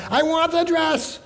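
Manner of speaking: put-on high, whiny child wailing for a dress; mock tantrum, high and whiny; screaming little girl.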